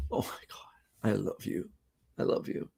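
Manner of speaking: breathless whisper of love. Acted. Wife whispering love while hugging husband. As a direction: whisper it close as you embrace. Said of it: "They hug and embrace".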